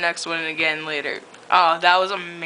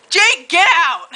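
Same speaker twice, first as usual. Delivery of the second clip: mock shout; 11 semitones higher, 3 dB louder, slower than usual. Edward yells, reader laughs.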